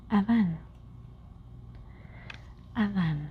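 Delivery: Adán with soft Spanish d, stress on last syllable. Shown as a demonstration. Teacher modelling Adán for learners, Spanish pronunciation. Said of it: "Adán usually has, um, accent there".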